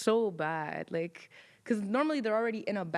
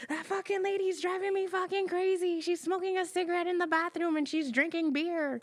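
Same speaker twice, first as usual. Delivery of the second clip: much higher-pitched, fed-up rant; exasperated coworker; 10 semitones higher than usual. Retold or imitated. Coworker complaining about the smoking patient, exasperated high rant. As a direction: vent it like a coworker at wit's end.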